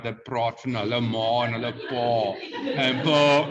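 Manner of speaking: imitated strong Pretoria accent. Teacher mocking how people in Pretoria talk, strong accent. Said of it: "like, a very strong accent".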